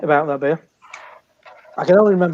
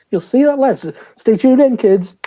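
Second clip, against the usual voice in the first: TV host voice; 8 semitones higher, 5 dB louder than usual. Pretend. Mock TV host teaser.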